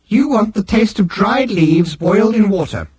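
high-pitched, put-on machine voice; Nutrimatic machine. A narrator's reading. Machine querying Arthur about tea, high incredulous voice.

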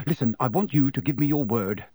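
ordinary delivery

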